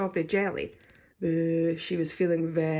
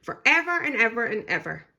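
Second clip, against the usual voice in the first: ordinary delivery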